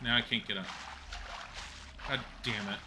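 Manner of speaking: usual speaking voice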